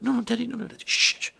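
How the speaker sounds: put-on high, hushed kid voice; urgently shushing dad as a child; pleading child. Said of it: "And they're like".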